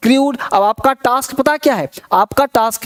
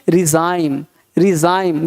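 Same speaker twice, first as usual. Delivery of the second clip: normal talking voice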